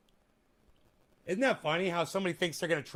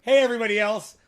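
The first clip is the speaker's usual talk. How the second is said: loud higher hail to everyone; cheery loud call-out; 4 semitones higher, 10 dB louder, faster than usual. Real, not acted. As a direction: call out to the whole room. Calling out to the audience.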